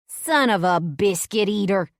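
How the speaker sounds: put-on loud startled old-lady yell. A narrator's reading. Granny yelling as the goat bursts through the crowd. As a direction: blurt it out as the goat charges. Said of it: "Granny yells".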